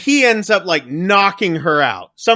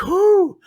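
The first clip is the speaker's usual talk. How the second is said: relieved whoo; 14 semitones higher than usual. Retold or imitated. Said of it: "And he's like".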